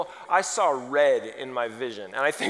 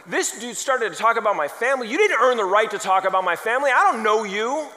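loud, higher, heated outrage; acted indignation; 7 semitones higher, 8 dB louder than usual. Father reacting to a salesman mentioning his family, loud indignation. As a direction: protest hotly at a stranger overstepping.

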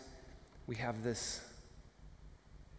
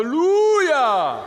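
loud, high, drawn-out hallelujah; preacher's hallelujah; 16 dB louder, slower than usual. Retold or imitated. Pastor repeats Eddie's booming preacher hallelujah. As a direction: ring it out like a gospel preacher. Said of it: "a preacher's voice".

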